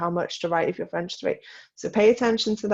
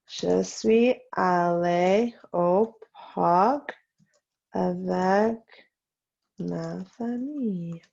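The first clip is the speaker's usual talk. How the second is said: slow dictation; much slower than usual. Shown as a demonstration. Teacher to class copying the park sentence, slow dictation. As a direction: dictate slowly with gaps between words.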